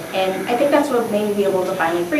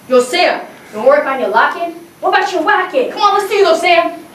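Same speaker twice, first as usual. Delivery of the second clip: higher and louder, egging Sam on; hyped teacher calling out; 7 semitones higher, 3 dB louder than usual. Retold or imitated. Teacher challenges Sam to dance, high and hyped. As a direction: come on, push him to dance.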